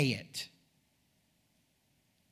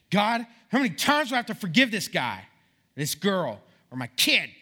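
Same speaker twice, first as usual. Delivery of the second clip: fed-up appeal to God, pitched up; exasperated plea; 4 semitones higher, 5 dB louder than usual. Acted. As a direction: complain to God, punching out the words. Voicing a fed-up prayer to God.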